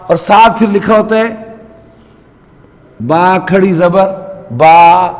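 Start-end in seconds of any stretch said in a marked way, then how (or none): 3.0-5.1 با stretched long for the standing zabar; teacher stretching با in the drill; long baa. Shown as a demonstration. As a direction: draw out با to the length of an alif. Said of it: "دونوں ایک جیسے ہیں".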